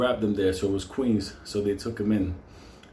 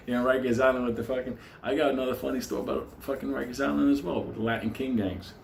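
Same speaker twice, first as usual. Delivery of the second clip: ordinary talk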